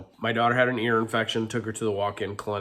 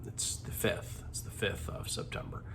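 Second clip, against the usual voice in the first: whispering; 9 dB quieter than usual. Real, not acted. Tired aside, drops to whisper.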